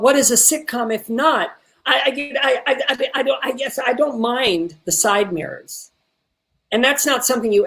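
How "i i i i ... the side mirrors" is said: stammered start, higher, then slowing; stuttering and stumbling. Shown as a demonstration. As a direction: stumble into the line searching for words. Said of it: "didn't wanna stutter or stumble".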